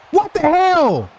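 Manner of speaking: high, loud disbelieving yell; shocked shout. Real, not acted. Gamer reacting to a terrible play, shouting in disbelief. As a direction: blurt it out in total disbelief.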